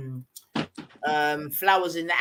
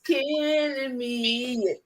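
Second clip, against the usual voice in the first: ordinary talk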